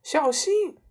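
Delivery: high, loud shriek of alarm; mother cries out in sudden alarm. A narrator's reading. Mother warning father as powder spills, shrieking. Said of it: "妈妈尖叫道".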